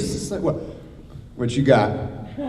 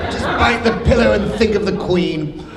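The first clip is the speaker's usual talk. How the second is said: mock customs officer; 8 semitones higher than usual. Mock advice aside.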